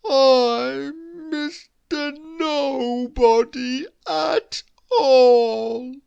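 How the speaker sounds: slow, high, loud wail; hammed-up melancholy moan; mournful. A narrator's reading. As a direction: drag out each word as if crying. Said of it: "came the mournful reply".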